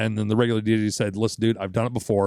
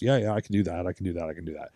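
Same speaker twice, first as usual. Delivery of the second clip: dismissive fading agreement; 5 semitones lower, 6 dB quieter, faster than usual. Retold or imitated. Brushing off stripper's instructions.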